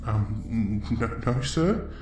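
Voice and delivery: soft, hesitant stammer; timid. Acted. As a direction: answer an intimidating elder meekly. Timid answer to ancestor.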